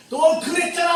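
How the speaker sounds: loud, high accusing snap; scolding accusation. Retold or imitated. Preacher imitating a nitpicking God scolding him, loud. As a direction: bark it like a petty accuser catching a fault.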